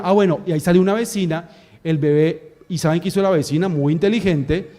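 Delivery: normal talking voice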